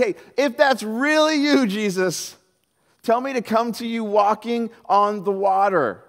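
slow, high, eager call to Jesus; high, stretched-out plea from the boat. Acted. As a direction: Peter calling out across the water. Peter calling out to Jesus.

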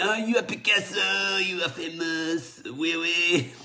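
lady fan gushing in a put-on French accent; high, excited French accent, hammed up. Star-struck lady to Picasso in a mock French accent. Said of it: "I won't do the accent anymore".

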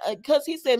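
normal talking voice

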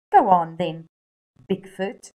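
joking. Acted. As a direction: tease the little brother playfully. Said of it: "joked Biff".

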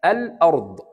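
الأرض as "al", then "ard", L clear; lam pronounced. Shown as a demonstration. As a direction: separate a sounded L in الأرض from the hamza. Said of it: "بنطق اللام".

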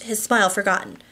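ordinary delivery

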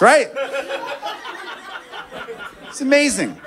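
ordinary talk

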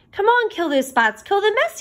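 yelled. A narrator's reading. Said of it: "yelled the cats".

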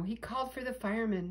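ordinary delivery

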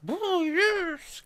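high excited chorus cry. Acted. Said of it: "cried the chorus".

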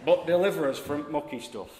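loud Yorkshire prayer line; Yorkshire accent. Acted. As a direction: proclaim in broad Yorkshire. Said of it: "In a Yorkshire accent".

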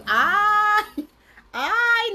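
agudo y fuerte ¡ay! indignado; exclamación indignada. Retold or imitated. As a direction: suelta un ¡ay! de indignación burlona. Se enoja cuando él se duerme. (ay agudo e indignado)

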